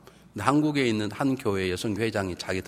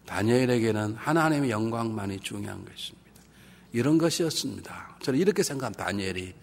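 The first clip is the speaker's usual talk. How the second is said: ordinary talk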